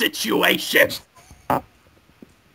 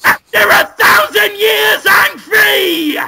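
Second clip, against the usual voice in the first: high, loud, gloating villain cry, the last part slow; triumphant villain shout; 8 semitones higher, 6 dB louder, much slower than usual. Acted. The freed villain gloating to Brendan Fraser, screaming in triumph. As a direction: roar in evil triumph at being freed. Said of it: "he says".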